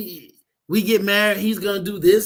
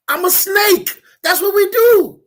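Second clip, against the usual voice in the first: high, loud, emphatic declaration; triumphant snake; 10 semitones higher, 7 dB louder than usual. Acted. Snake standing over the bitten rabbit, loudly unapologetic. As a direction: proclaim it loudly over your victim, pitch raised. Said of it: "the snake stands over the rabbit and says".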